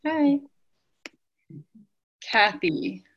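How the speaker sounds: usual speaking voice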